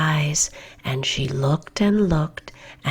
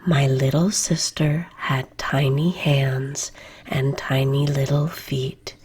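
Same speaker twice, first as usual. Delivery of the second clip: ordinary delivery, nothing marked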